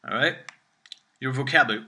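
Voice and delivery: ordinary talk, nothing marked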